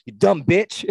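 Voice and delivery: hammed-up sarcastic yell at the TV; retold yelling. Fan retelling how he yells at players, mock angry yell. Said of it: "I was, like, yelling".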